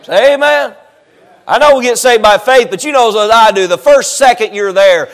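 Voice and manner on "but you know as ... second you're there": normal talking voice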